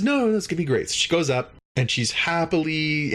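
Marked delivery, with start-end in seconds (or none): 0.0-0.9 high, eager mock enthusiasm; excited. Tendi welcomes a cleaning job, high mock-excited voice. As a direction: gush with pitched-up eagerness. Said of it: "She's really excited".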